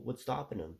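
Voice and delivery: ordinary talk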